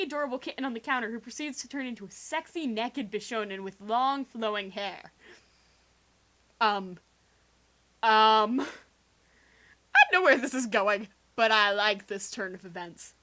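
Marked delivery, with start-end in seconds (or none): none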